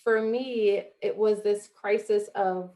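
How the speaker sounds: ordinary talk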